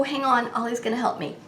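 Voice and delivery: ordinary delivery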